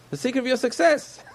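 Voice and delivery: squeaky mimic of curious outsiders; mocking outsider quote. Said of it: "They said".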